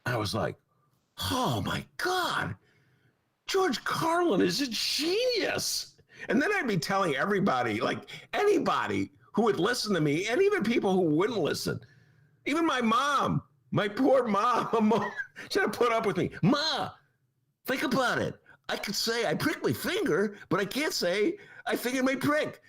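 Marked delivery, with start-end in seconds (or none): 1.3-6.0 high, slow, awestruck gush; wide-eyed teenage wonder, much higher; amazed teen. Retold or imitated. 16.4-22.6 put-on excited teen. Teen pestering his mom.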